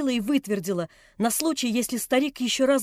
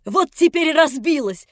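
louder, slow taunting triumph; defiant triumph; 4 semitones higher, 9 dB louder than usual. A narrator's reading. Nelli after smashing the cup, defiant triumph. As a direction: gloat over the broken cup. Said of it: "с каким-то вызывающим торжеством".

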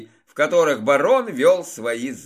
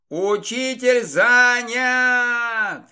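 drawn-out shout of занят; shouting after; 8 semitones higher, much slower than usual. A narrator's reading. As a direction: call out after someone, slow and high. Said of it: "крикнул мне вслед парень".

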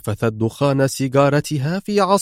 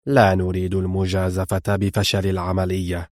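harsh sternness; 5 semitones lower, 4 dB quieter than usual. A narrator's reading. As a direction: lower and softer, coldly stern. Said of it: "قال في صرامة خشنة". (صرامة خشنة بصوت منخفض)

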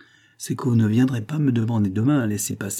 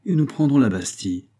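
usual speaking voice

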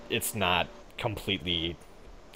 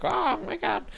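very high surprised squeal; slower than usual. Retold or imitated. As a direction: squeak out in shock. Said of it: "that's how I sound like when I'm surprised".

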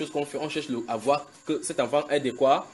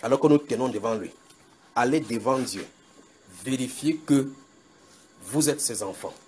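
slow, hushed earnest plea; solemn adjuration. Acted. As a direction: implore the congregation gravely.

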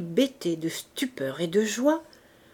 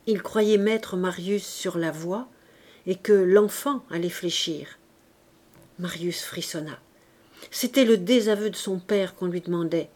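usual speaking voice

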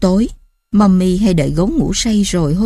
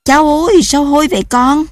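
high, louder hammed-up cry of disgust; mock outcry; 7 semitones higher, 3 dB louder than usual. Mother teasing her child about smelly feet, hammed-up outcry. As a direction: raise the alarm in playful mock horror. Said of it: "rồi la làng".

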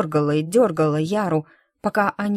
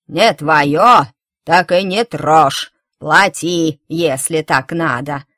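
put-on slow, louder crone barking at the girl; old woman snapping; 2 semitones lower, 8 dB louder, slower than usual. A narrator's reading. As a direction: shout her down like a nasty old woman. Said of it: "прикрикнула старуха".

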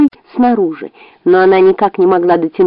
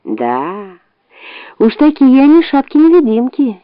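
usual speaking voice

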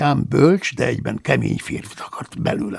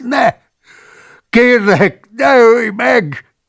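much higher, slow, frightened begging; pitiful whimpering plea; 11 semitones higher, 8 dB louder, much slower than usual. Acted. The caught monk begging not to be killed, whimpering. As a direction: beg for your life, whining pitifully. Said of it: "nyűszítette szánalmasan".

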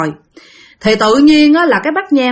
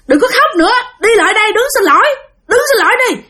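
put-on high, brisk order to the kids; commanding call; 12 semitones higher, faster than usual. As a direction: urge the kids to apologize. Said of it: "bà mới kêu rằng".

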